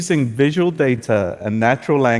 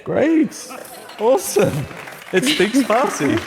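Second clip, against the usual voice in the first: much higher, delighted cheers; thrilled; 9 semitones higher than usual. Real, not acted. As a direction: burst out in real delight at the success. Demo succeeds in Farsi.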